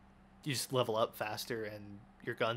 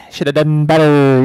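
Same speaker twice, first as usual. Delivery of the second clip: much louder mocking shout of the taunt; yelled taunt; 3 semitones higher, 24 dB louder than usual. Real, not acted. Gamer yelling a taunt at a beaten opponent, loud mocking shout. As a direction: rub it in at full volume.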